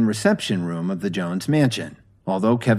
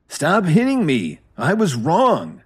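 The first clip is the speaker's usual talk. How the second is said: high, slow, louder pleading; begging; 8 semitones higher, 4 dB louder, slower than usual. A narrator's reading. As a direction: plead with him to stop, drawn out. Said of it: "Meriwether begged him".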